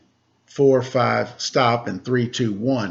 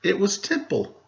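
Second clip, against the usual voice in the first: put-on bright, higher read-aloud voice for children; storybook narration; 9 semitones higher, slower than usual. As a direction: read it like a picture book to kids. Reading a picture book aloud.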